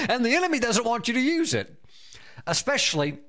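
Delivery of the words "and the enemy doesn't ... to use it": high, quick words on the tail of a laugh. Real, not acted. Preacher gloating that the enemy hates it, laughing. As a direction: talk through your laughter, pitched up.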